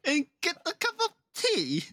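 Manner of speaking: put-on British accent. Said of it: "stop being Brits".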